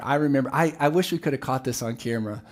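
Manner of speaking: usual speaking voice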